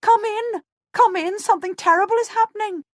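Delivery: high, loud, fast panicked pleading; frightened shout. A narrator's reading. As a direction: beg her to come inside in terror. Frightened servant shouting warning.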